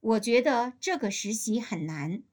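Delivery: ordinary talk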